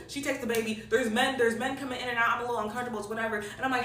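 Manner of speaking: ordinary talk, nothing marked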